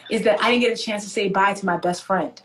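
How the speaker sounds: ordinary talk, nothing marked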